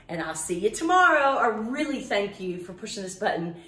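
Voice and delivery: ordinary talk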